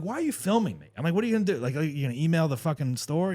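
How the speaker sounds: retold confrontation.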